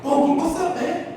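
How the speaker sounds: high, bright hello; high excited greeting to a friend; cheery greeting. Retold or imitated. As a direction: call out to a buddy, happy.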